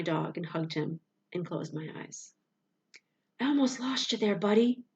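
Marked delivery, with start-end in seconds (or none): 3.4-4.8 wheezed. A narrator's reading. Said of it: "I wheezed into his fur".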